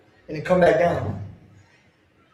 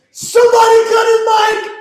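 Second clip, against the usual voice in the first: much higher, loud shout; shouted demand; 14 semitones higher, 5 dB louder than usual. Real, not acted. Fan demands the commentator be silenced, high loud shout. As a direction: bellow it at the whole room.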